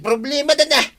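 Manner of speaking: vengeful outburst. Acted. Acted vow of revenge.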